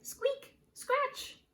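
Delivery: very high, tiny squeaky voice; squeaky storybook sound words; hatching noises. A narrator's reading. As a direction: voice the egg's little noises, way up high. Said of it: "The egg started to crack".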